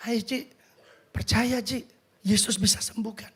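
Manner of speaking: softly urging, voiced murmur; soft, encouraging aside; retold bedside whisper. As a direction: gently coax someone in a hushed voice. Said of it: "saya cuma B C I".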